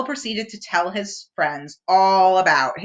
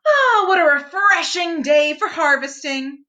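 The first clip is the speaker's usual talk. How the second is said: Rabbit voice; 7 semitones higher, 4 dB louder than usual. A narrator's reading. Rabbit voiced in read story.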